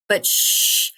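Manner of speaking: long hissed exhale; focused breathing. Shown as a demonstration. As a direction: breathe out through the pain. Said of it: "you focus on your breathing".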